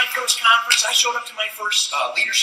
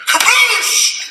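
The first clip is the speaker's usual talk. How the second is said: very high, loud laughing kaboosh; cartoon splash sound; splash noise; 5 dB louder than usual. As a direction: burst out with the splash. Imitated splash.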